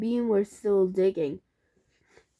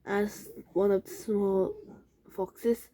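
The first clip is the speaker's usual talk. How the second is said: plain reading voice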